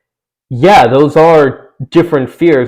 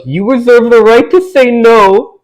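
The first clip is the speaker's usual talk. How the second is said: mocking; 11 semitones higher, 4 dB louder than usual. Mocking a cringy lyric quote.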